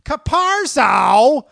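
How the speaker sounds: high, loud, slow drawn-out yell; Tom Hanks impression. Host's one-line Tom Hanks, high drawn-out shout. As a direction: call out to a fallen soldier. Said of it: "one line as Tom Hanks".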